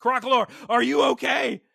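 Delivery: high, urgent shout; hammed-up worried yell; alarmed calling out. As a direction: call out to a hurt teammate. Calling to hurt Pokémon in recap.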